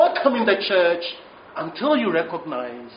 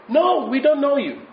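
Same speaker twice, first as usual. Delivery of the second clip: imitated gatekeeper, raised pitch; higher-pitched, emphatic rejection; firm refusal; 7 semitones higher, 3 dB louder than usual. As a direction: turn the caller away from inside. Temple voice refuses a second time.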